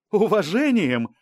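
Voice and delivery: high, scornful echo question; scoffing. A narrator's reading. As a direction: throw the word back in disbelief. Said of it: "усмехнулась Балбай".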